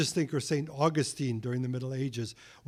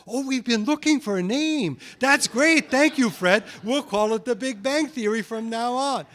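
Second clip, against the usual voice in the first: mock delighted; 8 semitones higher, 4 dB louder than usual. Rivals gleefully adopting the mocking name.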